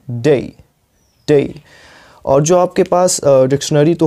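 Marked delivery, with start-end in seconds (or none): 0.1-1.6 डे as dei with a glide to i; correct day. Shown as a demonstration. Teacher models डे twice for students. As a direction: say डे ending in a light ee. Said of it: "तो हमें क्या बोलना है".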